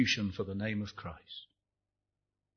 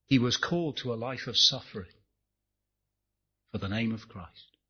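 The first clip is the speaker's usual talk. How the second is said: normal talking voice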